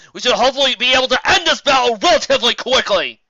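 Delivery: loud, high and hearty; a jolly griffin commander rallying the council; jolly booming griffin. A narrator's reading. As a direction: boom it out, pleased as punch. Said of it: "Gideon chuckled".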